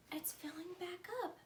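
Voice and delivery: excited unvoiced whisper. Felix whispering to himself about his bucket, hushed. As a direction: breathe it out in wonder.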